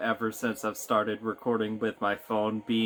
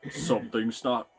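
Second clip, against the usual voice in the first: heavy voice. Pretend. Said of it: "I'm just doing the heavy voice again".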